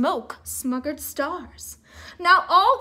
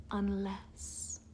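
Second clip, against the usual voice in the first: soft mysterious whisper; 7 semitones lower, 13 dB quieter than usual. A narrator's reading. Narrator whispering the single word left on the rocks.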